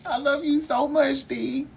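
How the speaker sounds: high, tearful thanks; close to tears. Real, not acted. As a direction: voice lifting as you start to cry for real. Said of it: "You gonna make me cry".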